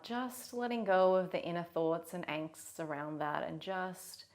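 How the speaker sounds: unhurried meditation guide with long pauses; guided meditation. Real, not acted. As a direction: gently lead them into the body.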